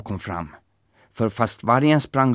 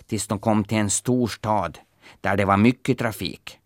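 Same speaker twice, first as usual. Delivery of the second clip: ordinary delivery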